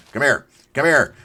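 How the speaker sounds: high, loud quick call; playful calling. Pretend. Player as cow chasing cows, playful loud call.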